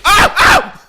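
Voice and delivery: high, loud yelping; sudden high "ow" shriek; yelp of pain. Pretend. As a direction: cry out at being hit. Hit in the game.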